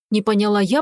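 usual speaking voice

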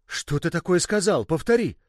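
put-on agitated boy. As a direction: demand he repeat it. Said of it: "вдруг разволновался мальчик".

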